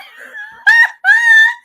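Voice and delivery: loud, very high shrieking laugh; screaming laugh. Real, not acted. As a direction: howl with laughter. Big laugh at own story.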